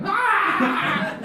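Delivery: sudden high shrieking laugh; loud laugh shout. Retold or imitated. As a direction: burst out with a shrill cackle. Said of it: "and then you just hear".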